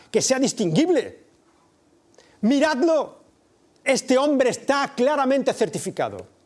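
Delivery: high, loud contemptuous shouting to a crowd; Pilate's scornful harangue. Acted. Pilate haranguing the crowd about Jesus, loud scornful shouting. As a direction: shout down at the mob, show them the wreck. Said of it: "Está diciendo Pilatos".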